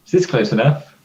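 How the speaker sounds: jokey. Acted. Player's character jokes to the AI about the bomb, teasing. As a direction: toss it off as a joke. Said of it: "in a jokey way".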